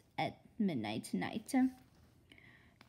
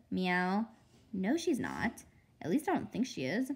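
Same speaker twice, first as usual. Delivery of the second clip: ordinary delivery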